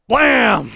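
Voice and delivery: loud, high "Blam!"; mock gunshot. Reader acts out the gun in the comic with a loud "Blam!". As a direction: shout the gunshot noise.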